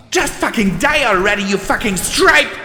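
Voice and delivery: high, loud, fast scream. Acted. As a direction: scream at her to die. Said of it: "she screamed".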